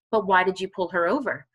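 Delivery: ordinary delivery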